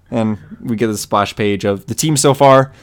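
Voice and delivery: ordinary talk, nothing marked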